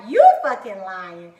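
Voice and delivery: loud, stunned yelling at a friend; disbelieving shout. Retold or imitated. Telling her coworker she must be lying, loud and stunned. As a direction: yell it like you can't believe her.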